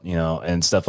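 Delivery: normal talking voice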